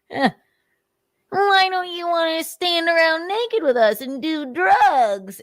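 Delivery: high, slow sneering mock of a party guy; whiny mock invitation; mocking Nick. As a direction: sneer it like a dumb pushy host. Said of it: "Nick's like".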